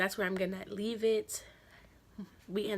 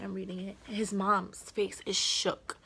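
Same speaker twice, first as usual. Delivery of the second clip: ordinary talk, nothing marked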